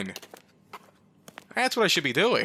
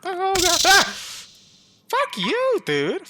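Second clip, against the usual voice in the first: very high, loud shrieks of fright; panicked scream; 14 semitones higher, 7 dB louder, slower than usual. Real, not acted. Player terrified as the spider returns, shrieking.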